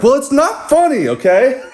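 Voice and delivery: very high, huffy retort; imitated scolding girl. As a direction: snap back in a high voice.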